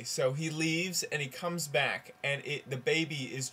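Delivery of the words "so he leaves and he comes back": usual speaking voice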